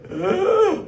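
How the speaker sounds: high, loud startled gasp. Retold or imitated. A woman slipping at the post office, high loud gasp. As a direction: gasp in shock as she slips. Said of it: "this lady comes and just goes".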